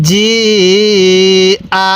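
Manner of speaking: long steady "জি", then short "আ"; four-alif stretch. Shown as a demonstration. Teacher showing learners the hamza rule, long "জি", short "আ". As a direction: stretch "জি" out and land briefly on "আ". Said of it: "চার আলিফ টান দিতে হবে".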